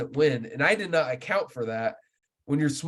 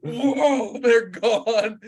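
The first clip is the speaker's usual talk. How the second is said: mock dismay; 10 semitones higher, 5 dB louder, much slower than usual. Fish scatter after bad cast.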